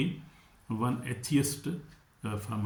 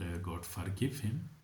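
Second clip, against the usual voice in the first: ordinary delivery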